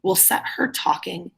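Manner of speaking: usual speaking voice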